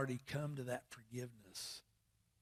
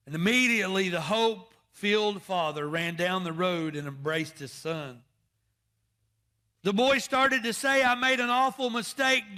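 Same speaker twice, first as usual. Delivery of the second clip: loud, rising preacher's cry; full-force preaching; 8 semitones higher, 10 dB louder than usual. Acted. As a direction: preach it out to the pews.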